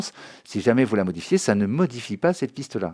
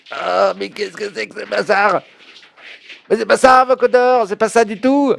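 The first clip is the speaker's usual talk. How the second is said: high, loud frustrated exclamation; exasperated outburst; 11 semitones higher, 8 dB louder than usual. Retold or imitated. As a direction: blurt it out in frustration. Exasperated inner thought about vocoder.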